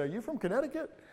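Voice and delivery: mock-innocent question, pitched high; teasing question. Pastor teasing a Georgian with a high, mock-innocent question. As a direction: ask it like you really think so. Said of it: "I say".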